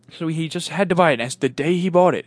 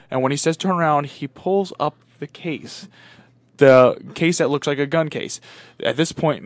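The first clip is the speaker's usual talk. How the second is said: ordinary delivery, nothing marked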